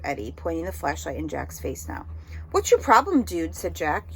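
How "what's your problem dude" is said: sharp, much higher and louder challenge; challenging bully. Acted. Jack confronting Eddie, loud and high challenge. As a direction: call him out hard.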